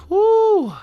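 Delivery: a long, high exhale-cry; high release of emotion; high woo. Real, not acted. Overwhelmed after talking about the film.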